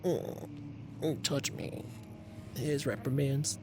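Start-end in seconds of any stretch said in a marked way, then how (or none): 0.0-1.8 breathy high mocking moan; mock sultry voice. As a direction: tease it in a fake seductive voice. Mock voicing a fan request.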